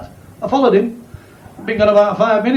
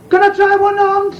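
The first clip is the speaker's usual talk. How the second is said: high-pitched, polite customer asking; raised, timid voice asking about shoes; 10 semitones higher than usual. Acted. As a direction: play the shy customer up high.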